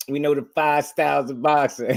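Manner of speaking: high, sure mock boast; convinced boast impression. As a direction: say it fully convinced. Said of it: "He sounded convinced".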